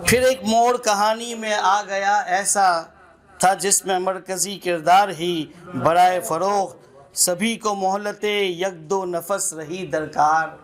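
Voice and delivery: measured, lilting recital with repeated lines; poem recitation. Acted. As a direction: savour each line like a poet at a mushaira. Recites a nazm at a gathering. (नज़्म पढ़ने का अंदाज़)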